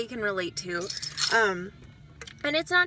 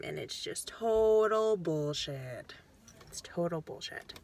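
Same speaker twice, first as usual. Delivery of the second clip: upset; 6 semitones lower, 5 dB quieter than usual. Real, not acted. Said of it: "I'm sorry if I'm getting upset".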